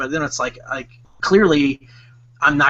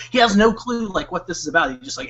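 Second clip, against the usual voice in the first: ordinary delivery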